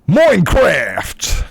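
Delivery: slow, high, drawn-out Australian "Minecraft!"; over-the-top Australian exclamation; broad Australian. Pretend. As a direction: stretch it out like a caricature Aussie. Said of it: "the most Australian thing I can say".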